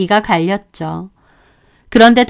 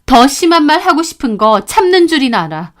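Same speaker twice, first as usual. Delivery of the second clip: ordinary delivery, nothing marked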